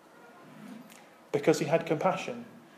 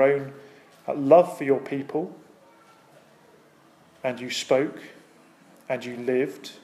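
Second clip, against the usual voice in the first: closing prayer; 3 semitones lower than usual. Acted. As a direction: pray reverently over the congregation.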